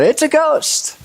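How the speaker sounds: high, frightened cry of a ghost sighting; terrified cry. Acted. Disciples in the storm, a high frightened cry. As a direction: shriek it like a scared fisherman. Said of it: "terrified and cried out".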